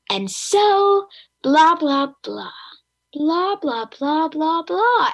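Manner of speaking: mock slow read-aloud, high and loud; slow reading parody. Adult reading really slowly to kids, mocking imitation. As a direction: imitate a plodding kids' narrator in exaggerated singsong. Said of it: "so they read really slowly".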